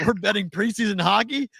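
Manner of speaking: high, disbelieving question; can't believe the bet; incredulous. Retold or imitated. Replying to Addison's tip, high and disbelieving. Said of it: "I was like".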